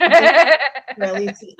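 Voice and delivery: slow, loud, laughing through the words. Real, not acted. Joking to her co-host about time left, laughing.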